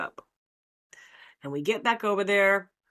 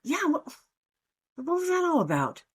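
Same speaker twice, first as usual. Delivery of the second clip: high, innocent confusion; clueless retelling; 9 semitones higher than usual. Retold or imitated. Asking her friends what the fuss was, high and clueless. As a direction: play dumb, wide-eyed. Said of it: "And I'm like".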